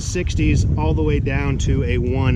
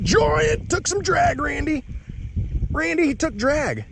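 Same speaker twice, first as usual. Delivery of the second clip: high, slow, stretched shout to a buddy; excited call; 12 semitones higher, slower than usual. Real, not acted. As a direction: call it out like a fish is on. Fish on, calling his buddy.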